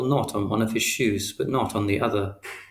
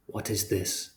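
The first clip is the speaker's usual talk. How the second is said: very quiet; 7 dB quieter, slower than usual. A narrator's reading. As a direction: hold the anger under a hush. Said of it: "But he said it very quietly".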